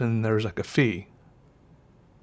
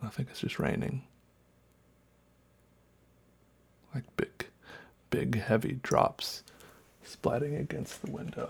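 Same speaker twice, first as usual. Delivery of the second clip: whispering; 8 dB quieter than usual. Real, not acted. Hears noises outside at night.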